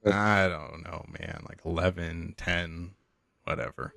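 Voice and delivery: low, soft, lazy mumble of a vacationer; imagined laid-back guy, barely bothering; super laid back. Pretend. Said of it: "He's probably super laid back".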